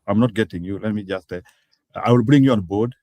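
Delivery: ordinary talk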